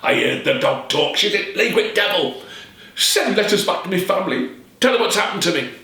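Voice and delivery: shouting. Acted. Cursed peddler shouting from his sickbed. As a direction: cry it out in terror. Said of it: "Law shouted".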